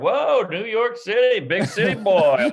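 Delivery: slow, drawn-out wide-eyed wonder; mock a country boy marveling at the city; awed small-town driver.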